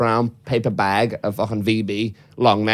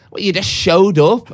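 higher, drawn-out incredulous question; disbelieving friends; 6 semitones higher, 4 dB louder, slower than usual. Retold or imitated. Mates reacting to his surprise visit, imitated disbelief. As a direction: question it slowly, in mock disbelief.

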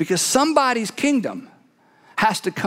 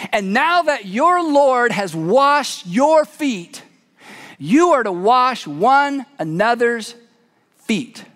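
solemn slow instruction; much slower than usual. Acted. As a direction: lay it down slowly as a lesson. Jesus instructs after washing feet.

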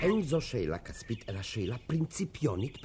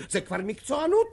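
alarmed exclamation; 13 semitones higher, 8 dB louder than usual. Acted. Official rising alarm over money.

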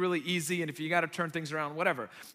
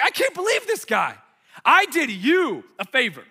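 high, loud exasperated yell; retold yelling; 8 semitones higher, 9 dB louder than usual. Driver yelling at the car behind him, high indignant shout. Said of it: "I'm yelling at him".

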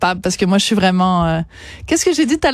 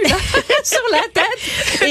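laughing; 11 semitones higher, slower than usual. Real, not acted. Joking about the Just Dance avatar.

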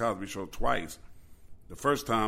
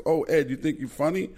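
Mike Tyson; 6 semitones higher, 4 dB louder than usual. Retold or imitated. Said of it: "So Mike goes".

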